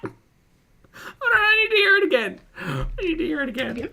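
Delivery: high, laughing hold on; laughing disbelief. Real, not acted. Hearing the mouth whispering again, laughing. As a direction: crack up and beg to hear it again.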